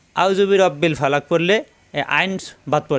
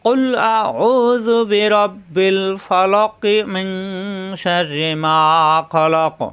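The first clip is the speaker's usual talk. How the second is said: joined recitation; 3 semitones higher, much slower than usual. Shown as a demonstration. Teacher showing how to join the verse in one reading. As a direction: chant the verse through without stopping. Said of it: "সেক্ষেত্রে কীভাবে পড়বো?".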